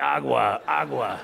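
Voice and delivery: loud drilled repetition of a word; mock teacher drill. Comedian mocks the immersion teacher drilling water. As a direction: bark the word like a drill instructor.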